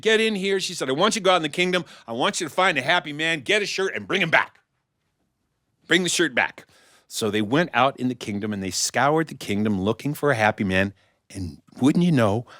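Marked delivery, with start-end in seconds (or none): 0.0-6.7 high, brisk royal orders; commanding queen. Acted. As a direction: send the guard off at once.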